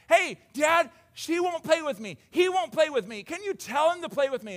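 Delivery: put-on high, loud child tattling to Dad; bickering kids. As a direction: complain to a parent like a wronged kid. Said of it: "this bickering back and forth".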